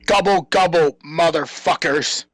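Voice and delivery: ordinary talk, nothing marked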